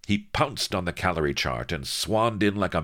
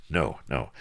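ordinary delivery, nothing marked

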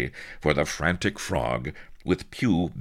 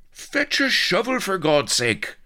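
loud, high desperate shout; roaring; 5 dB louder than usual. A narrator's reading. Half-buried Blood calling for rescue, desperate roar. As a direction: roar for help, pitch starting at the top. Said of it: "roared Blood".

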